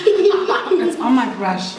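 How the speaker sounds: slow, laughing disbelief; nervous giggle through the words. Real, not acted. Chameleon crawling on her brush.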